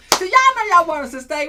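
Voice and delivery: bright playful tease to the audience; playful excited tease. Real, not acted. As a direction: hype it up like you know they love you.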